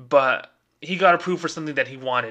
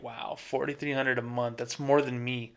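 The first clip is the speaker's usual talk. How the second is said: a quiet, awed wow at the number, much softer; stunned hush; 8 dB quieter than usual. Retold or imitated. Banker hearing a homeless customer out-earns him, hushed awe. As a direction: let it sink in, amazed and hushed. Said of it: "immediately I'm like".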